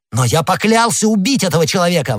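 high, fierce declaration of a vow; vehement exclamation. A narrator's reading. D'Artagnan exclaims to Madame Bonacieux about the stranger, vehemently. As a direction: proclaim it hotly. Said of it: "воскликнул Д'Артаньян".

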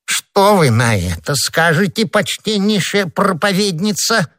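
drawn-out, rising sarcastic question; mocking taunt. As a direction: taunt her with exaggerated politeness, slowly. Husband taunts his wife.

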